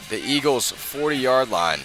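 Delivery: ordinary delivery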